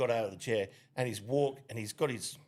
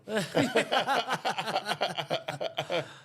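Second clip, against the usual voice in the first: laughter; 7 semitones higher than usual. Real, not acted. Laughing at sponsor joke.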